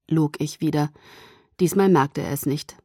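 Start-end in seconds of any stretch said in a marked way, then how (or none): none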